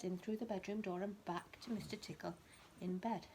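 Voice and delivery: usual speaking voice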